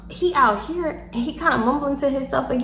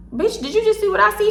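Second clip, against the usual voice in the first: excited; 10 semitones higher than usual. Retold or imitated. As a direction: ask your friend in shock. Excited aside to friend Ryan.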